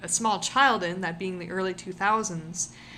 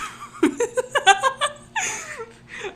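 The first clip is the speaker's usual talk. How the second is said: wheezing high laugh; squealing laughter breaks through; helpless high cackle; 7 dB louder than usual. Real, not acted. Laughing fit mid-rant.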